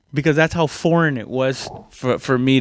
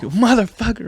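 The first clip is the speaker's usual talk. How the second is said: pained curse; 8 semitones higher than usual. Retold or imitated. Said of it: "I'd be like".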